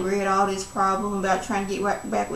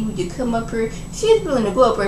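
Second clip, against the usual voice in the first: ordinary talk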